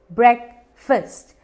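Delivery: ब्रेकफास्ट as "brek-fust", both vowels short; two slow syllables in ब्रेकफास्ट, "brek... fust"; correct pronunciation. Shown as a demonstration. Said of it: "ये है simple". (ब्रेकफास्ट को धीरे "ब्रेक-फ़स्ट" बोलें, छोटे स्वर)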